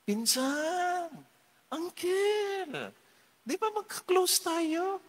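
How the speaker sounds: high, sweet, fawning plea; butter up the rich cousin; wheedling relative. Retold or imitated. Relatives asking for a share.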